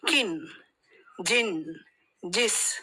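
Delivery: brief vowel in each of "Kin, jin, jis"; short i vowel. Shown as a demonstration. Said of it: "gives a short e sound".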